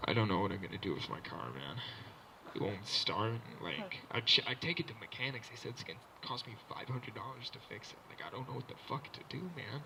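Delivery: low, quiet, defeated mumble; hard-luck guy imitation, sunk low; down-on-his-luck dude. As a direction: grumble your car troubles, low and soft. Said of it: "this down on his luck dude".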